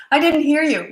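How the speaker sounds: high, loud mock kid yell. Child yells over the parent, mock loud shout. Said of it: "you chose to yell and not listen".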